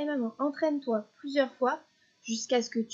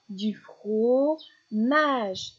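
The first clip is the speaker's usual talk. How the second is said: "fromage" very slow, with "fro" and "mage" held apart; syllable by syllable; 4 dB louder, much slower than usual. Shown as a demonstration. Teacher isolating the last syllable for a child, "fromage" drawn out. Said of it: "les dernières syllabes qui sont répétées".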